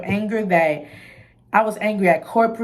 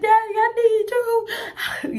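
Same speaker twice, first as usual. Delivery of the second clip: high, slow, tearful wail; retold crying plea; much slower than usual. Begging her daddy on the phone, high slow crying wail. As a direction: stretch it out like a sobbing kid. Retelling crying call to father.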